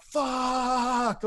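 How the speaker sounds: long, high-pitched held cry, volume reined in; retold scream. Drunk man walking that line, mock screaming. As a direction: stretch the vowel into an imitated scream. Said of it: "like, screaming".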